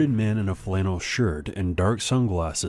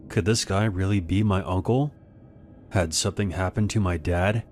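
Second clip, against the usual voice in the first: ordinary delivery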